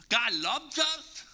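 slow tender emphasis. Real, not acted. Pastor telling his church, tenderly and slowly. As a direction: let it land gently. Preacher stressing God's love.

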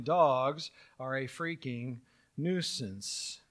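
each word read out slowly, like a discovered text; slow, mock-solemn quote. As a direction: intone it slowly, like scripture. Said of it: "they discover this line".

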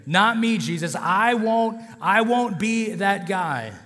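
high, loud protest, acted as Peter; emphatic acted vow. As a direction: push back hard, leaning on each refusal. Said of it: "He was emphatic with it even".

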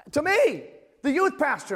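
high, loud, mock offended; indignant disbelief. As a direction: land it like you can't believe it. Counselor mistook him for a teen.

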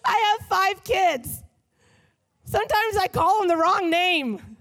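loud, high preaching; preaching at full force. Real, not acted. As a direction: preach with rising fervor.

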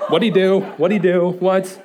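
loud, excited rapid-fire questions; eager questioning. Retold or imitated. Dad hearing wife's news about the baby, excited. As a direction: press urgently for the news.

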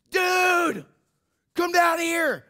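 high, loud drawn-out yell; calling out. Retold or imitated. Friend calling him downstairs, imitated high shout. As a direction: holler it up to a friend upstairs.

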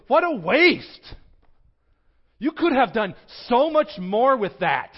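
loud, high indignant cry of protest; scolding outburst. Retold or imitated. Disciples scolding the woman, loud indignant outburst. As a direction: blast it out like an outraged disciple. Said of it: "they go".

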